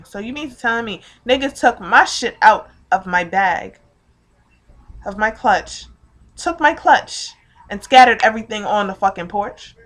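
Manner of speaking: loud, incredulous outrage; pissed off. Retold or imitated. Learning her clutch was emptied out, outraged. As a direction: retell it with heated disbelief. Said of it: "I was so pissed off".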